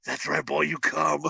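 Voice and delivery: low, soft creepy murmur in the ear; creepy stepfather. Retold or imitated. Stepfather whispers in his ear, low creepy murmur. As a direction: leer it softly, close and low.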